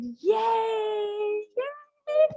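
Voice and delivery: high, loud triumphant yays; ecstatic cheer. Shown as a demonstration. Teacher ending the laughter chant with a loud cheer. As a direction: cheer at the top of your voice. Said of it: "Then we go".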